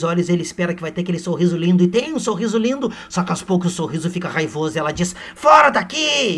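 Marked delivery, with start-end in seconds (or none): 5.3-6.3 high, loud, slow yell; mock furious shout. Aurélia throwing Seixas out, loud mock shout.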